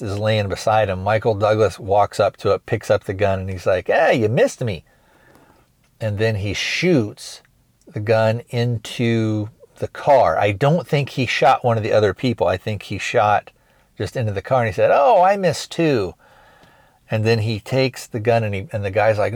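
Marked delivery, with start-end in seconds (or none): 3.9-4.8 higher-pitched, sing-out taunt; mock taunt. Movie fan retelling a gunman's jeer, mock taunting. 14.9-16.1 high, drawn-out "oh", feigned regret; mock surprise. Retelling a gunman who shot into the car, mock surprise.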